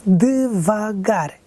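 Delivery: devagar split slowly into de-va-gar; syllable by syllable. Shown as a demonstration. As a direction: stretch devagar, one syllable at a time. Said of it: "dividir silabicamente uma palavra". (devagar dividido em sílabas, bem lento)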